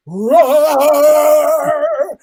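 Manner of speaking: high, loud roaring blast; fireball roar. Retold or imitated. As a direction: let it roar like flames bursting. The mortar exploding.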